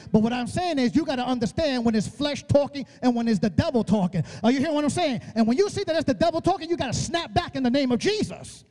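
usual speaking voice

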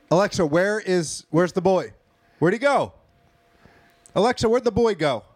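mock frantic calling. Parent asking Alexa where's the boy, mock frantic. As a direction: call out as if it won't answer.

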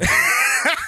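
loud high shriek of laughter. Real, not acted. Guest laughing hard at the host's Krusty Burger joke. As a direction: burst out laughing loudly.

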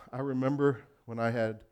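usual speaking voice

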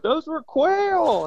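high, slow and drawn out; retold dad's line. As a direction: stretch out each word, pitched well up. Dad's answer, retold by the son.

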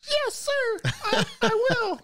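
put-on very high, slow childlike squeak; old man squeaking like an obedient boy; old man as kid. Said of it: "seventy-five-year-old man, is like".

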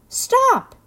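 sudden high, loud cry; an abrupt, commanding storybook shout. A narrator's reading. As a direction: cut through the argument with a shout. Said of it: "It rumbled loudly like thunder".

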